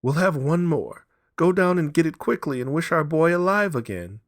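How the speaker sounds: high, loud urgent plea; wife begging husband to wish; feverish demand. A narrator's reading. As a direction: drive him to fetch it.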